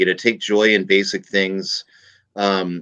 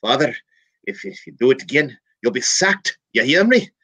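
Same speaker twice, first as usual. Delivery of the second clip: higher, building to a loud "You hear me?"; stern bishop; 5 semitones higher, 3 dB louder than usual. Retold or imitated. Bishop gives the priest his last warning, rising stern. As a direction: threaten the priest, rising to a shout. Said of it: "He calls him back in".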